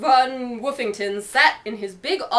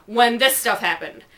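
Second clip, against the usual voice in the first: plain reading voice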